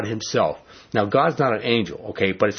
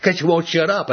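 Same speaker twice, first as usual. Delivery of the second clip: annoyed retort; 6 semitones higher than usual. Retold or imitated. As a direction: bite back at the talking dog. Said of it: "that's what I would say to him".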